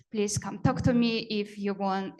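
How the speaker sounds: normal talking voice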